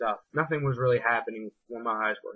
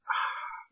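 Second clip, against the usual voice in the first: long breathy sigh of relief; sinking onto the bed with a sigh; relieved sigh; 8 dB quieter than usual. Retold or imitated. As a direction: let the air out in relief. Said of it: "I lay on the bed and I'm just like".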